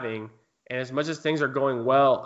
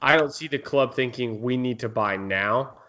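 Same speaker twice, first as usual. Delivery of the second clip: ordinary delivery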